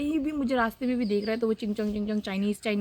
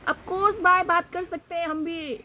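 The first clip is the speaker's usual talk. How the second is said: indignant retort; 8 semitones higher, slower than usual. Real, not acted. Retorting to surprised people.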